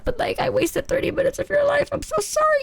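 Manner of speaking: high-pitched mock-pleading apology; guilty apology. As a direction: retell it as a pleading sorry, voice way up.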